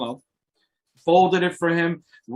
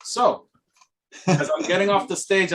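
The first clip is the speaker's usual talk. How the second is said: ordinary delivery, nothing marked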